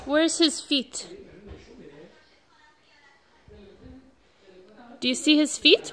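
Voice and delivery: sing-song picture book questions. Real, not acted. Parent reads picture book to toddler.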